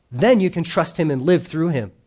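ordinary talk, nothing marked

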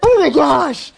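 mock terror of being arrested; panicked laughing. Pastor reenacting panicking while laughing at the library. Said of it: "And I was like".

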